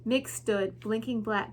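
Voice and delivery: ordinary delivery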